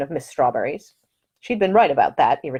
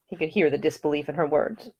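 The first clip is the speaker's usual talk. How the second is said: ordinary delivery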